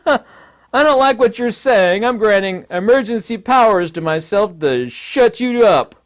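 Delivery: slow, loud, hammed-up tyrant decree; mock despot. A despot granting himself emergency powers, slow, loud and smug. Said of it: "fancy way of saying".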